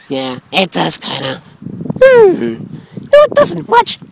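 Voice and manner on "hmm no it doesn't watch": high character voice. Acted. Character voice in snow game.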